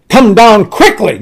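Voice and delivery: the king losing patience, push it harder; really mad command. Retold or imitated. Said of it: "Now I'm really mad".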